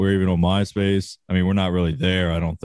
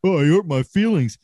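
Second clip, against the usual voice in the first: mocking whine; 8 semitones higher than usual. Mocking people who get offended, in a sarcastic whiny voice. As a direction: mimic a crybaby with contempt. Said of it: "pussies out here crying".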